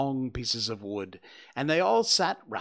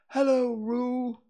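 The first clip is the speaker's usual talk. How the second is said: Pooh's voice; 11 semitones higher, 4 dB louder, slower than usual. Acted.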